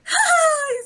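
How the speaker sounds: high, gleeful little ha ha; child's laugh. Retold or imitated. Dad imitating his son laughing at Bill Nye, high giggle. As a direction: mimic a toddler giggling. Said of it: "he's laughing".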